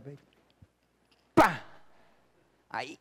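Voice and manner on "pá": loud, sudden "pá" of a slap; explosive imitated whack; imitated smack. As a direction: punch out the sound of a slipper hit. Imitates the slipper hit.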